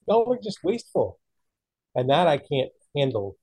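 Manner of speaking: ordinary talk, nothing marked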